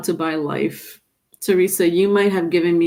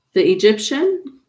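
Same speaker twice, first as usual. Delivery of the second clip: ordinary talk, nothing marked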